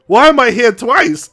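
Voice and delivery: baffled at seeing himself; puzzled colonel asking; confused. Retold or imitated. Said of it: "Colonel Ulrich's like".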